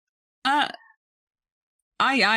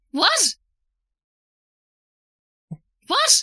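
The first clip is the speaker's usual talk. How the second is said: shocked; 13 semitones higher, 6 dB louder than usual. Acted. Shocked by parents' secret past.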